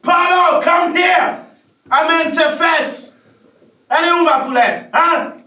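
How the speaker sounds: high, loud shouting for Polo; angry roar, rising in pitch; furious yell. Acted. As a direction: bellow at the assistant. Director furious, summoning assistant.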